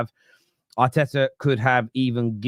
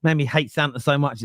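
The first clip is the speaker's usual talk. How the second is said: ordinary delivery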